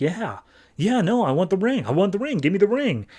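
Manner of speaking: hammed-up greedy begging for the ring; mock eager. Hal greedily wants ring.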